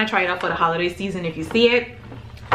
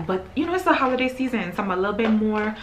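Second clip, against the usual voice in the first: ordinary talk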